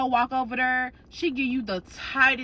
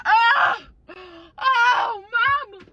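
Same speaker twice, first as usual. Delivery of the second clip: mock wailing cry; 11 semitones higher, 4 dB louder, slower than usual. Mama wails at funeral.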